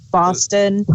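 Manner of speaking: normal talking voice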